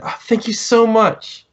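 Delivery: high, slow, grateful sister; grateful woman's voice. Acted. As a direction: pitch up and thank him slowly. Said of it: "she grabs his hand".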